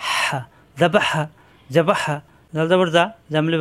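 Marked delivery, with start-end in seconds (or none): 0.1-1.2 হা pushed from the middle of the throat, slowly; slow, throaty হা demo; throat-pressed ha. Shown as a demonstration. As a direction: squeeze হা out from the throat. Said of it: "কণ্ঠনালীর মাঝখানে চাপ দিয়ে".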